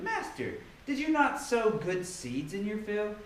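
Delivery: ordinary talk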